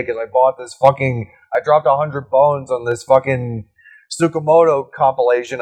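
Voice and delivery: ordinary talk, nothing marked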